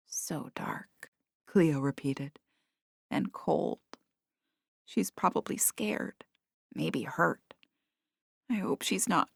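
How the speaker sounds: soft, half-whispered worry; barely above a whisper, scared for her friend; hushed and sad. A narrator's reading. Cat grieving quietly in dark.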